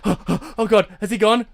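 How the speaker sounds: high, loud acted gasping; panting fear. Player checks if the minotaur has gone, panting fear. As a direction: puff it out as if fleeing for your life. Acting out winded fleeing player.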